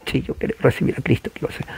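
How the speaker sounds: hushed, rushed and whispery; quick, faint whimpered acceptance; mock tearful convert. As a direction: imitate an overcome convert gasping out consent. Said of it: "los que están llorando".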